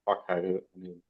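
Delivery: ordinary talk, nothing marked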